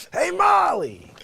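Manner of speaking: high, loud and slow booming call; Billy Gardell's comeback call. Retold or imitated. Imitating the comic returning to the stage, loud and slow. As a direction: stretch the name out big. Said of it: "he grabs the microphone".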